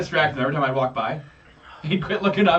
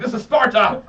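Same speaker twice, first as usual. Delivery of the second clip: raised, hammed-up movie battle line; mock war cry; 5 semitones higher, 4 dB louder than usual. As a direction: imitate a heroic kick-off line. Movie war cry, kicking man.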